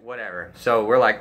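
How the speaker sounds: ordinary talk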